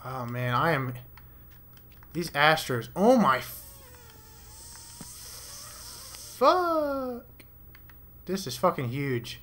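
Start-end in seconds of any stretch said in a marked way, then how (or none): 3.0-7.2 startled cry. Real, not acted. Player startled by something in the cave, high yelp. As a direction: jump in fright and cry out.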